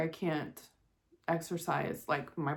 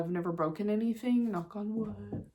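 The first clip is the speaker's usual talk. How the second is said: usual speaking voice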